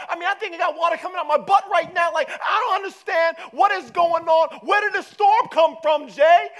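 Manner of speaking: high, shouted comic rant; panicked protest. Acted. Disciples to Jesus in the storm, panicked shouting. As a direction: yell in exasperated, mounting panic.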